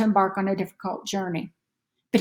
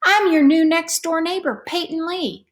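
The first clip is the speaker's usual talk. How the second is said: put-on higher, friendly little-girl voice; young neighbor girl voiced higher; new girl; 6 semitones higher than usual. A narrator's reading. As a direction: introduce yourself sweetly to a new neighbor. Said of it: "A girl hidden deep inside a parka said".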